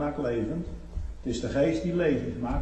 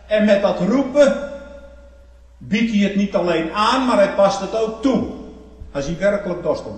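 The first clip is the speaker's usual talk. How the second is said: full-force preaching; 7 semitones higher, 9 dB louder than usual. Acted. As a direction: proclaim it to the congregation, voice raised.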